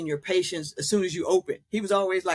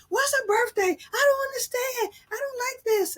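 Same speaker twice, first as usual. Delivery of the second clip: much higher, crying out in distress; imitating distressed mother. Mother panicking at the birthday singing, crying out much higher. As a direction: cry out like a frightened, confused parent.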